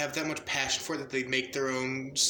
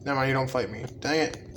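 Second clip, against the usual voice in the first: ordinary delivery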